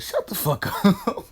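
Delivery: high yell that cracks into a laugh; high mock yell, laughing. Tsunade silences the whole village, high laughing yell. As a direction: shriek it up high and laugh through it.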